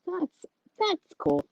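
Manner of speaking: high, slow voice. Real, not acted. Reacting to daughter's laugh.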